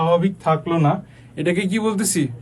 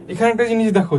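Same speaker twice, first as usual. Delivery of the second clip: normal talking voice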